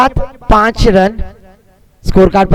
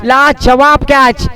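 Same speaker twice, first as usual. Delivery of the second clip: slow savoring praise; much slower than usual. Real, not acted. Catch praised slowly.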